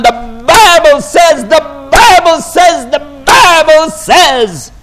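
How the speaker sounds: loud, slow, hammered shouting preacher. Retold or imitated. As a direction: pound it out like a fire-and-brimstone preacher. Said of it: "slapping the book, telling them".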